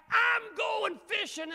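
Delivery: high, loud slow shout; frustrated Peter. Retold or imitated. Preacher voicing Peter quitting to go fishing, loud. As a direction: fling it out fed up. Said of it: "They were discouraged".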